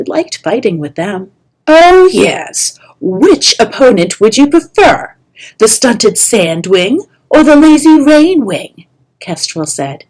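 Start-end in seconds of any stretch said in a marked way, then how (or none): 1.7-8.9 sarcastic mockery. Kestrel mocking Clay's wish.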